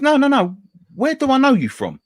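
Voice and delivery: high-pitched, insistent retold challenge; aggressive. Taxi driver confronts him in the street, imitated aggressive insistence. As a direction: press the question, imitating a pushy stranger. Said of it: "he's quite, quite aggressive".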